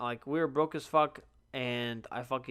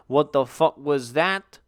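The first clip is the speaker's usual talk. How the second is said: retold alarm; 8 dB louder, slower than usual. Creeped out, retold reaction.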